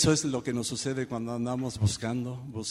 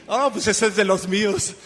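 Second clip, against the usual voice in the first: delighted; 7 semitones higher, 8 dB louder than usual. Retold or imitated. Delighted at tennis answers.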